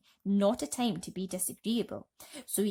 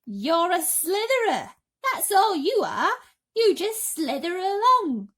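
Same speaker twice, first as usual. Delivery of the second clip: higher, louder mocking; higher, slower bug taunt; taunting centipede; 11 semitones higher, 9 dB louder than usual. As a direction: jeer at the worm.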